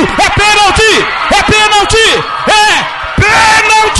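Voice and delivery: rising shouted penalty call. Real, not acted. Narrator calls a penalty. (grito de pênalti)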